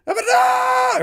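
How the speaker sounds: high, loud drawn-out battle cry; imitated shout. Podcaster imitating Rex's shout in Japanese, full-voiced shout. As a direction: belt it out like an anime hero.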